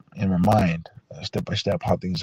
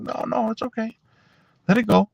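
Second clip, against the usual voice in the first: kind stranger's voice; 12 semitones higher than usual. Retold or imitated. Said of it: "He was like".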